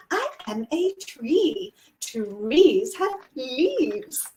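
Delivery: very slow, over-articulated British elocution recital; proper British elocution. Shown as a demonstration. Former pupil reciting her elocution class poem, slow proper British accent. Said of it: "I got sent to elocution classes".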